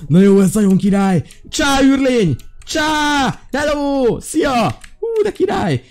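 excited shout; excited greeting. Real, not acted. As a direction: call out to the alien, delighted. Greets alien through voice changer.

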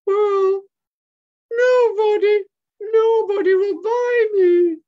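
very high, loud, drawn-out monkey wail; Michaela's sad wail. Acted. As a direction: wail slowly like a forlorn puppet. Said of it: "And Michaela says".

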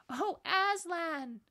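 high, loud drawn-out cry; overwhelmed child's call; amazed cry. A narrator's reading. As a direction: cry the name in stunned joy. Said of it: "cried both children".

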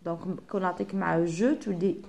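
ordinary talk, nothing marked

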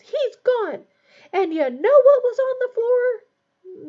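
loud, very high, drawn-out accusing yell; distraught yell. A narrator's reading. Ron accusing Hermione over his rat, distraught yell. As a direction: yell accusingly, pitch soaring. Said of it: "Ron yelled into the stunned silence".

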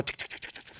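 quick voiceless clicking chatter; imitated typing sounds. Comic telling of a dog at a computer, imitated typing. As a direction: tap out keyboard noises with your mouth, very softly. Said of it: "Y el perro empezó".

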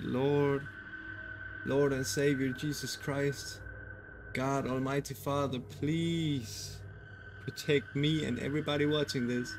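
soft, slow, halting prayer; hushed prayer. Pretend. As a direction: pray quietly for protection, with long pauses. Anxious prayer during scary story.

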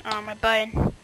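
ordinary delivery

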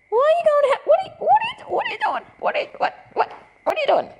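very high, stuttering playful questions; pet-talk voice. Real, not acted. Talking to her dog.

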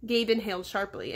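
usual speaking voice

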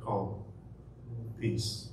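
soft, low calm to the storm; quiet, soothing peace; calm command. Retold or imitated. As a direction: hush the storm gently. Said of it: "say to the storm".

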